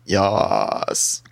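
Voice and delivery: very low, drawn-out creature reply; deep guttural voice. Acted. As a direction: drop right down into a guttural growl. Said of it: "the deep guttural voice say".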